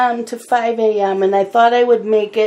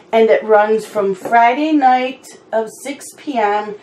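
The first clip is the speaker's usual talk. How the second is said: normal talking voice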